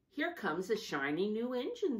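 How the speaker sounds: a lightly voiced funny clown; excited clown. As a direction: announce it like a cheerful clown. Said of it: "said the funny little clown".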